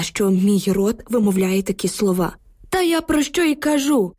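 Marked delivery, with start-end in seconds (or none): 2.7-4.1 put-on higher, exclaimed old man's voice; emotional exclaim. A narrator's reading. Old man insists to the women that tanks came, exclaiming. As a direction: burst out emotionally. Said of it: "емоційно вигукнув дід".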